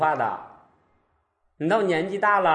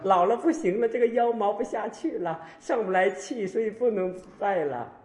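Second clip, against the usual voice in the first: raised, put-on aged voice; complaining old devotee; 6 semitones higher than usual. Old devotee excuses skipping prostrations, complaining. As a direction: recite the predictable excuses as an old person. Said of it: "我都知道下边要讲什么了".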